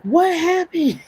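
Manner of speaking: very high, loud panicked demand; hammed-up worried mom voice; alarmed mother. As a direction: imitate a mom shrieking in alarm. Mom reacting to injury call.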